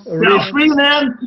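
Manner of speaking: high, slow mock English twang; mock English accent. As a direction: ham up an English accent, teasing.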